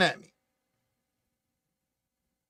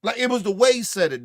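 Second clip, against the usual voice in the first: ordinary delivery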